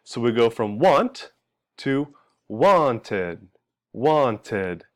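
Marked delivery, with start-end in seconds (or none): 2.5-4.8 "wanted" drawn out as "wan-tid"; extra ID syllable. Shown as a demonstration. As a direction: stretch "wanted" into two clear syllables, falling. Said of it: "we're adding an extra syllable".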